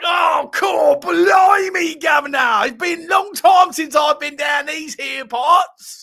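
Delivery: shouted high Cockney, drawn out slowly; mock Cockney. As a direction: play the new West Ham signing gone full Cockney. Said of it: "Deadline day to Upton Park".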